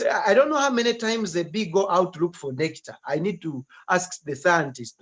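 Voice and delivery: usual speaking voice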